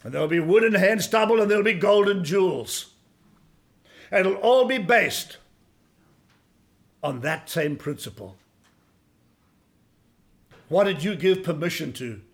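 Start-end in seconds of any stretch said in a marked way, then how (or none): none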